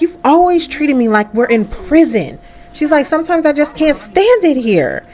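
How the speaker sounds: put-on high-pitched teenage-style protest; exasperated complaint. Daughter complaining in acted argument.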